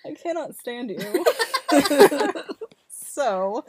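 high, slow, through a giggle fit; laughing. Real, not acted. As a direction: laugh while you scold.

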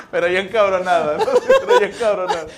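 loud words through laughter; laughing. Real, not acted. Laughing as he jokes about his wife angry, loud. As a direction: push it out while cracking up.

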